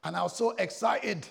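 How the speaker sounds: put-on loud, high-pitched excitement in own accent; excited. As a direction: say it excitedly, projecting to the room. Own accent before West London take.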